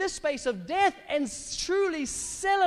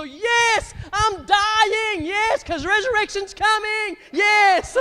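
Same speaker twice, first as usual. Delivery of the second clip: high, loud, drawn-out exultant cry; jubilant, full-voiced celebration; triumphant shout; 9 semitones higher, 7 dB louder, much slower than usual. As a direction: shout it like a victory. Acts out rejoicing while dying.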